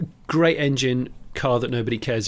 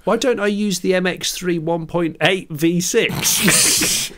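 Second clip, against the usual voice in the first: higher, slower and louder, thrilled by the idea; sudden excited idea; 8 semitones higher, 4 dB louder, slower than usual. Real, not acted. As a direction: blurt it out as a brilliant wild plan. Sudden idea for a mad build.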